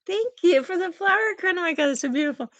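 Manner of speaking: high-pitched, delighted gratitude; gushing thanks. Real, not acted. Streamer thanking viewer for flower crown, gushing. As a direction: gush sweetly over a little gift.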